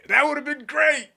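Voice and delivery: very high, loud squeal; over-the-top high-pitched excitement; squealing delight. Real, not acted. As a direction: shriek it in giddy delight. Delighted by own imagined accent bit.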